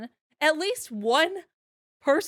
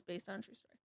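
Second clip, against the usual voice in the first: very soft, trailing-off reading; hushed quote; 2 semitones lower, 20 dB quieter, faster than usual. Retold or imitated. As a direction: drop right down to quote the line. Reads out the cover line.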